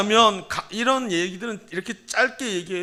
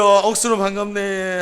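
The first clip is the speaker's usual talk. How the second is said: Gyeongsang dialect greeting; 2 semitones higher, 5 dB louder than usual. Shown as a demonstration. Pastor prompts greeting front and back, loud Gyeongsang accent. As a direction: model it loudly in Gyeongsang accent. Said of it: "경상도 말로 인사합시다".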